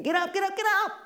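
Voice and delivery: high, loud frantic yell; panicked yelling. Retold or imitated. Someone barging in yelling to wake a sleeper, high frantic shout. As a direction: shout to wake him, voice jumping way up. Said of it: "yelling and screaming and panicking".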